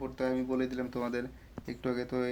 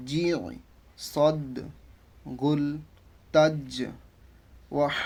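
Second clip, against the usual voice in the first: loud, deliberate word-by-word recitation; Quranic drill recitation; 4 dB louder, much slower than usual. Shown as a demonstration. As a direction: chant each drill word apart, holding long vowels. Teacher recites revision word list. (কুরআন শেখানোর তিলাওয়াতের ভঙ্গিতে)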